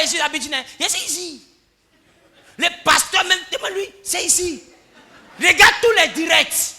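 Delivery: outraged member refusing to move from his spot; indignant churchgoer. Acted. Churchgoer refusing to change seats.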